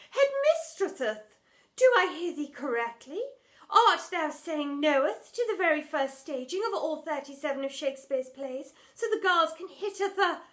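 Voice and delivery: loud, high indignant; outraged thespian. Acted. Drama teacher indignant at headmistress, loud. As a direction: declaim your outrage. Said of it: "an insult of epic proportions".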